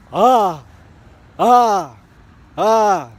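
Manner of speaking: loud high scream, ah ah ah; demo scream. Shown as a demonstration. Scaring off charging dogs with loud high screams. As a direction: bellow each ah to scare off a dog. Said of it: "I continued to scream the".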